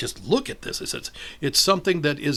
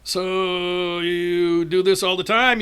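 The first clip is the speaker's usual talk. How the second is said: drawn-out, higher lead-in to a question; chatty stranger; 5 semitones higher, 6 dB louder, much slower than usual. Retold or imitated. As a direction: slowly sound out a new acquaintance. Acted stranger's small talk.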